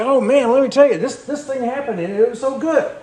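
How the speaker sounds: mock gushing. Preacher mimics friends raving about a film, excited. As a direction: gush like a friend bragging about a great movie.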